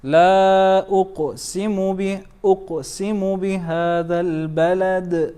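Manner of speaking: chanted, small q bounce in أقسم, full d echo on البلد; minor then major qalqala. Shown as a demonstration. Teacher showing minor and kubra, chanted echoes on أقسم and البلد. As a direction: stretch لا, touch أقسم lightly, echo البلد. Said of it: "It's a minor".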